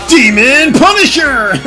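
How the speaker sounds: slow, loud announcer call; fighting game announcer. Pretend. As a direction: boom the move name like a hype announcer. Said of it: "You're like a football video game announcer".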